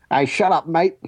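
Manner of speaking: quick Australian "shut up, mate", lightly put on; a casual Australian bloke's brush-off; Australian accent. Podcast host imitating Tarantino's cameo, in an Australian accent. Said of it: "that's a better Australian accent".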